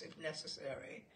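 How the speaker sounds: usual speaking voice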